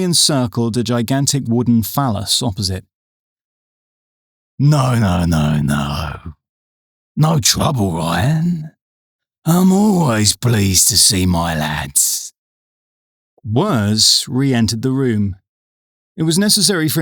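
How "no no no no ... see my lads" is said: smooth menacing boss voice. A narrator's reading. Moses voiced reassuringly.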